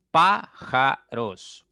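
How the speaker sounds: slow, loud "Pájaros" split as Pá - ja - ros; "Pájaros" stretched syllable by syllable, firmly. Shown as a demonstration. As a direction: sound out "Pájaros" in three separate beats, projected. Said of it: "Tiene tres sílabas".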